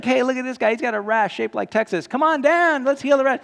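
loud, high hyped-up host call; excited game show announcer. Retold or imitated. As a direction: hype the crowd like a TV host. Game show call about rash guy.

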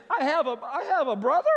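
high, loud, rising disbelief; incredulous question. Retold or imitated. Impostor caught out by a question about family, mock bewilderment. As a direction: blurt it as a baffled question, hammed up. Startled thought at unexpected question.

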